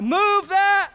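high, loud crowd chant; crowd shout. Retold or imitated. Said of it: "what do they shout".

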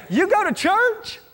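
high, drawn-out incredulous question; mock disbelief. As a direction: react in exaggerated shock, stretched out slowly. Coworker's shocked reply, acted out.

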